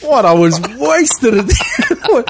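laughing protest. Real, not acted. Laughing protest at friend's teasing.

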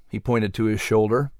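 ordinary delivery, nothing marked

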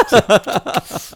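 ordinary delivery

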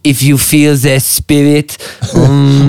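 slow, hushed French psychic; mock French accent. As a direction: lure the spirits in a French accent. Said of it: "French psychic, she's like".

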